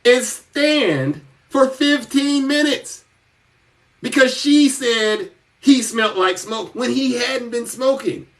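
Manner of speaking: ordinary delivery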